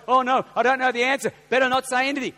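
high, loud mock alarm; mock panic. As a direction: fret loudly as if caught out. Acts out panic at not knowing.

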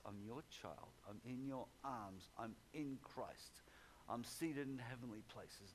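a hushed example prayer for the congregation. Shown as a demonstration. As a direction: pray it quietly, as if alone with God. Models a prayer of trust.

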